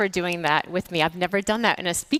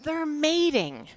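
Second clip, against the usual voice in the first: higher, slow "oh, they're mating"; dawning realisation; 8 semitones higher, much slower than usual. Retold or imitated. Speaker realising the dragonflies are mating, slow surprise. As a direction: let the penny drop slowly. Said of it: "And I realize".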